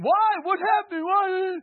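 high, loud, desperate cry; anguished shout. Retold or imitated. Couple whose marriage is failing crying out, shouted. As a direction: shout it like a broken spouse. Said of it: "they're wondering".

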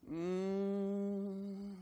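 long, soft held hum; moan in the spirit. Shown as a demonstration. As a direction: demonstrate a worshipful moan. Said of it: "learning how to moan in the spirit".

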